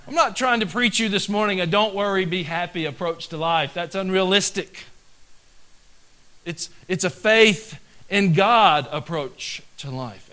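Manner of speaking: loud, high, slowed-down preaching; fiery preaching. Acted. Pastor exhorting the congregation, full-force preaching. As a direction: bear down on every phrase like a revival preacher.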